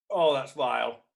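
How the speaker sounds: disgusted, talking through food; mouth full of fish. Real, not acted. To the audience while eating fish, disgusted mouthful. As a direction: speak around a mouthful.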